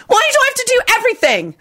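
fast, very high indignant yell; yelling back. A narrator's reading. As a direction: rattle off a shrill complaint. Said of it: "Dorcas yelled back".

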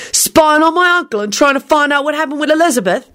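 high-pitched, accusing question; hot demand. A narrator's reading. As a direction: challenge with heated indignation. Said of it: "he demanded hotly".

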